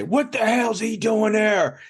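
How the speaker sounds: high, slow, mock outraged boss yelling; imitating angry boss. Boss spots the kid cooking, mock outraged and high. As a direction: lightly play the boss catching him at the stove. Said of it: "He'd say".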